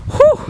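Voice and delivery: sharp high whew from the cold; cold gasp. Real, not acted. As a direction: gasp at the freezing air. Gasping in freezing outdoors.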